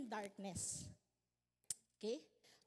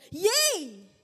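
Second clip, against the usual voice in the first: "Yea" blasted out loud and high; climactic shout; 3 semitones higher, 15 dB louder than usual. Acted. Preacher to the congregation, a sudden loud climactic "Yea". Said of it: "parang meron siyang climax ba?".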